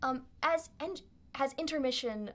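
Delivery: ordinary delivery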